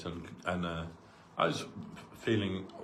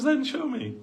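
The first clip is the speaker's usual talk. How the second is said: higher, coaxing elder asking to see the fax; playful insisting guru. Retold or imitated. Guru coaxing his disciple to hand over the fax, playful and higher. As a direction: press him gently, half teasing. Gurudev voiced, very high.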